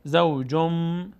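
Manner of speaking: زوجم very slowly, final m held; n turned to m. Shown as a demonstration. Teacher shows the change, زوجم with long m. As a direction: hum out the end of زوجم.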